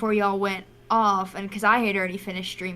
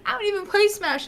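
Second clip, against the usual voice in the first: much higher wailed protest; repeated quote of own clip, high and emotional; high exclaim; 10 semitones higher than usual. Retold or imitated. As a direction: pitch it up, half-crying. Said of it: "So I'm like".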